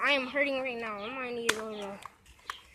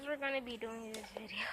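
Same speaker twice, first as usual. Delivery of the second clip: soft whispered intro; whispering; 6 semitones lower, 17 dB quieter than usual. Real, not acted. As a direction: whisper it to the camera.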